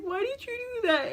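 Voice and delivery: very high, slow mock wail; bawling. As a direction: wail it as if overcome with grateful tears. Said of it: "I just started bawling".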